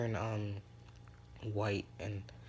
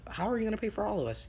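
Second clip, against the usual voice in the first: much higher, fast, incredulous; high fast question; 11 semitones higher, 3 dB louder, faster than usual. Acted. Friends doubting Izuku can pay, high and fast. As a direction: rush it out amazed. Friends ask about paying.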